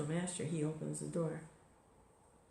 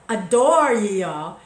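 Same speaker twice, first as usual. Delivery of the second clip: loud, slow declaiming, higher pitched; emphatic prayer recitation; 4 semitones higher, 15 dB louder, slower than usual. Acted. Teacher relishing the pun on a door, loud drawn-out recitation. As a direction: belt out "adore ye all", drawing out the DOOR.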